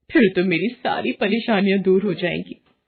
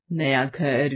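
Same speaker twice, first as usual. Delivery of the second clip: ordinary delivery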